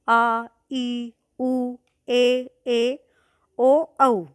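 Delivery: each vowel of "आ, ई, उ, ए, ए, ओ, औ" held long and level. Shown as a demonstration. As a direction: recite "आ, ई, उ, ए, ए, ओ, औ" one drawn-out vowel at a time. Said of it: "उच्चारण में दो गुना समय लगता है".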